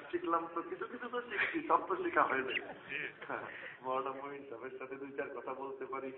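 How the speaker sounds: ordinary talk, nothing marked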